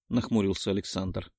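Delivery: plain reading voice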